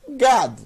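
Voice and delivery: Gad with G, A and D run together; read as one syllable. Shown as a demonstration. Teacher blending letters for learners, Gad as one syllable. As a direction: say Gad in one beat, closing on D. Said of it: "é uma sílaba só".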